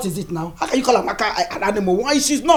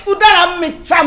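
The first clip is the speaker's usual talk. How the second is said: joyful shout; 12 semitones higher, 10 dB louder than usual. Acted. Mother-in-law shouts her joy to the neighbours. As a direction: shout it out high and loud. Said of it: "Mama, shout it".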